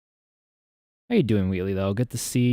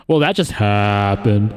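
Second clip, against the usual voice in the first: slow, louder, lower delivery with a stretched last word; drawn-out catchphrase; 3 semitones lower, 4 dB louder, slower than usual. Pretend. Host reacting to a mishap, hammed-up slow catchphrase. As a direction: drag out "happened" for comic effect.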